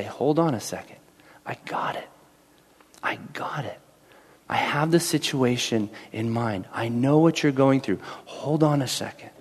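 soft, hushed, partly whispered comfort; the Lord whispering comfort to the anxious; whispered reassurance. Retold or imitated. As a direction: breathe the words like a secret promise. The Lord's voice reassuring.

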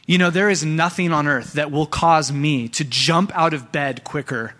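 ordinary talk

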